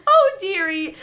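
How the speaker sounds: high, loud doting-granny voice; changed grandma voice. Acted. Ramen lady pretends to be your grandma, completely changed voice. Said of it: "Her voice has completely changed".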